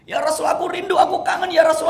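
high, loud cry of longing; longing shout. Acted. Thauban clinging to the Prophet, loud high cry. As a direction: shout it through tears.

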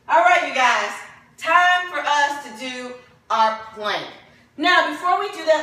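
ordinary delivery

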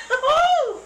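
laughing outburst. Real, not acted.

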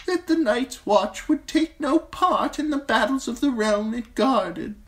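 an ancient maester teaching a hard lesson; old maester voice. Acted. Old maester lectures Jon on the Night's Watch vows, high and grave. Maester Aemon's long speech.

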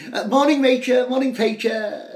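put-on young son shouting greetings in a high posh voice; shouting posh boy. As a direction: burst in calling good morning to the parents. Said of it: "shouted the young junior".

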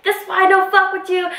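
high-pitched heated mock yell; retold yelling. Still arguing with the man as friends call, high mock yelling. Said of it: "I was still yelling at Evan".

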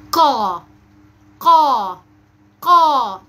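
قا loud and long, from the back of the tongue; forceful heavy qaf. Shown as a demonstration. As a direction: drill a strong, drawn-out قا. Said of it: "النطق بقوة أثناء نطق القاف". (قاف مفخمة بقوة)

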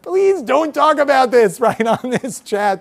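high, loud mock plea with a laugh; retold yell. Speaker scolding relatives over the family chat, mock yelling. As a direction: imitate an exasperated telling-off, pitched well up. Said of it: "privately yelled at".

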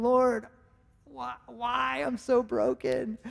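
half-joking cry of defeat; mock despair. Father butting heads with son over pools, mock despairing.

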